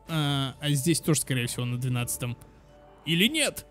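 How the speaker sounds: normal talking voice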